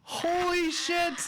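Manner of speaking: drawn-out, high-pitched amazement; slow squeal of astonishment; astonished. Real, not acted. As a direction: stretch it out in real disbelief. Astonished by the accent demo.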